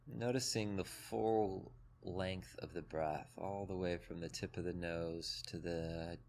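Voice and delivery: calm, even meditation voice with long pauses; guided meditation. Acted. Teacher leading a group through breath and body, guided meditation. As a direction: guide them gently into the body, leaving space between phrases.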